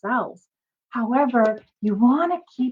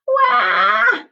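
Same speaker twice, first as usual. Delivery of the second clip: loud wail; 11 semitones higher, 6 dB louder than usual. Acted. Anna cries over bitten doll.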